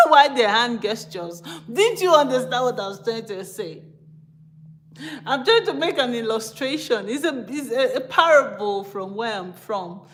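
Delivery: bursts of loud laughter while talking; giggling helplessly mid-sentence; laughing. Real, not acted. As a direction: laugh hard at yourself. Laughing at her own gestures.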